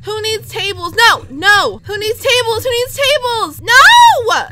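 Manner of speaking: high-pitched cries, yelled noes; frantic protest. Real, not acted. Gamer watches the table move, frantic high protest.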